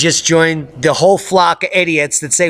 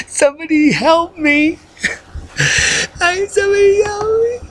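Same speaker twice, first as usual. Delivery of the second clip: high, wailing hammed-up plea; mock cry for help; 11 semitones higher than usual. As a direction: wail for help as if stranded in snow.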